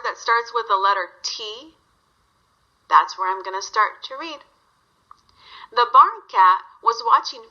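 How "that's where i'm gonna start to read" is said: ordinary delivery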